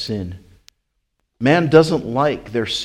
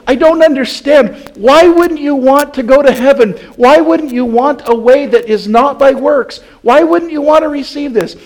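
exasperated disbelief; 14 dB louder than usual. Pretend. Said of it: "we scratch our heads and we say".

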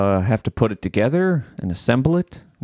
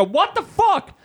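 very high, squeaky disbelief; mock outrage; slower than usual. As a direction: shriek a comic what the fuck. Retold outrage at another delay.

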